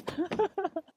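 squeaky breathless giggle; high nervous laugh. Real, not acted. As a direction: laugh off the fright. After the scream. (높고 숨찬 웃음)

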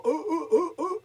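high loud laughing bear ah; ah in the cartoon bear voice. Shown as a demonstration. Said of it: "Voz de oso". (ah con voz de oso)

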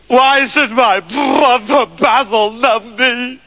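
high, drawn-out wail; theatrical remorseful blubbering; hammed-up sobbing. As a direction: dissolve into mock bawling on each word. Septimus weeping with remorse.

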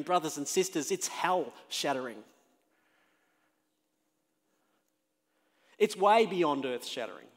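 loud, high, building sermon; preaching at full force. Acted. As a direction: drive it home from the pulpit. Preaching climax.

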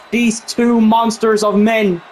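normal talking voice

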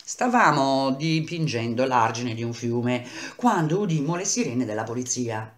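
plain reading voice

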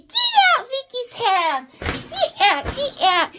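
high, loud shooing; mock shouted eviction. Acts out shooing everyone out.

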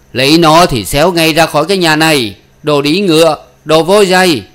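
high, loud father's shouting; enraged bellowed dismissal; furious banishment. Acted. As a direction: throw the daughter out with insults. Father throws daughter out.

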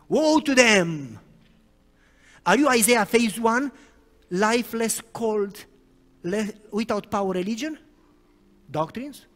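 preacher rebuking lifeless religion; fiery preaching. Acted. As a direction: thunder the warning over the congregation. Preaching at full force.

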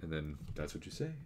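ordinary delivery

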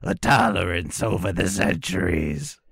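high, slow demon voice; dark lord. Pretend. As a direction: sneer it as an ancient demon. Heroin demon speaks.

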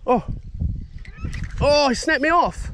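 sudden high yelp of dismay as the line snaps; real surprise at losing the fish; shocked outcry. Real, not acted.

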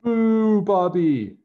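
loud mocking boo from the stands; jeering yell. A narrator's reading. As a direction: boo him loudly, like a rival crowd. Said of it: "yelled the other team".

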